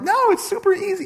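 mimicking people brushing it off, high and chirpy; mock breezy reassurance. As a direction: toss it off in a silly high voice. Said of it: "they're like".

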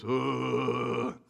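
long, droning monster groan; monster grunt. Retold or imitated. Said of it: "he talks like junior high boys".

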